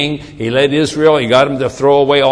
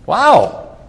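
higher-pitched, incredulous reaction; astonished, pitched-up disbelief; acted amazement; 4 semitones higher than usual. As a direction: marvel at an unexpected reprieve. Acts amazed reprieved man.

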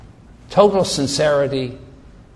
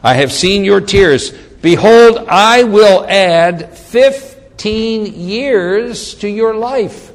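raised, ringing proclamation; scripture declamation; 3 semitones higher, 4 dB louder, slower than usual. Acted. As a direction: announce the promise grandly, stretching key words. Reads God's promise.

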